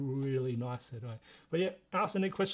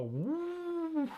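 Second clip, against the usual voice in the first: long, high rising "woo" like a revving car; car turbo whoosh; 13 semitones higher than usual. Retold or imitated. Streamer shows what the turbo button does, car-like whoosh. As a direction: imitate a turbo kicking in, drawn out and high. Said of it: "just like a car".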